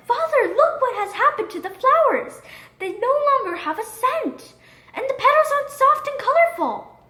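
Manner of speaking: put-on high, distressed little girl voice; a young daughter close to tears over the flowers; upset daughter. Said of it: "She began to cry".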